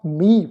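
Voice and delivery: ਮੀਂਹ said as one syllable, mi, final h silent, nasal vowel; teaching pronunciation. Shown as a demonstration. Teacher modelling ਮੀਂਹ for the sangat, final h silent. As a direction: model ਮੀਂਹ for the class as plain mi. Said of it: "ਇਹ ਸ਼ਬਦ ਦਾ ਜਿਹੜਾ ਅਰਥ ਹੈ".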